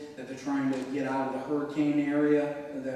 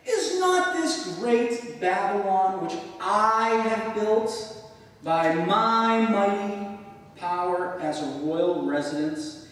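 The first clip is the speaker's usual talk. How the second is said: louder, slow and higher, proud king; boastful king; 5 semitones higher, 7 dB louder, slower than usual. Acted. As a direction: boom it out like a king admiring his empire. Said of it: "Notice the emphasis".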